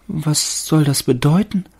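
high, rising, bewildered tree voice; fir tree puzzled. A narrator's reading. Fir tree dragged into the dark attic, high bewildered voice. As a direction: ask in confusion. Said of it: "dachte der Baum".

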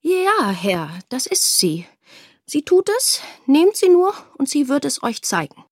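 an intimidated father rushing to please; eager. A narrator's reading. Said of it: "nickte sogleich eifrig". (eifrig und unterwürfig, deutlich höher)

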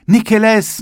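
high, loud call of "Nikeles"; excited schoolboy cry; eager shout. A narrator's reading. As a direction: schoolboys calling at the shop window.

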